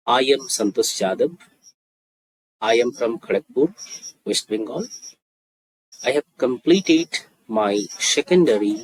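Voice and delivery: very slow, phrase by phrase; slow it right down so learners can practise; slow demonstration. Shown as a demonstration. Teacher repeats a student's introduction for practice, very slowly. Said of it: "আস্তে আস্তে বলছি যাতে আমার স্পিচটা ঠিকঠাক শোনা যায়".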